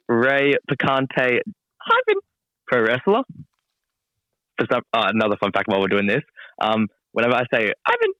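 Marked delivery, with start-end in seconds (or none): 1.8-2.2 high little squeak on one word; squeaky voice. Shown as a demonstration. Wrestler spelling out his social handle, squeaky voice. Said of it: "like, a squeaky voice". 7.9-8.1 tiny high-pitched squeak; squeaky voice. Shown as a demonstration. Wrestler explaining how he says hyphen, squeaky voice. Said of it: "like, a squeaky voice".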